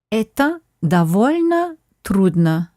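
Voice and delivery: "Это довольно трудно" said slowly and clearly; slow model phrase. Shown as a demonstration. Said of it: "Try saying that".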